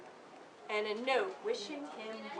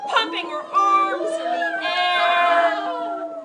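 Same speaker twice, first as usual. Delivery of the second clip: triumphant; 7 semitones higher, 12 dB louder, slower than usual. A narrator's reading. Victory celebration in story.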